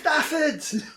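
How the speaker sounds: very high squeaky wail; gnome cry. Acted. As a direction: squeal in panic like a tiny gnome. Gnomes wail beyond door.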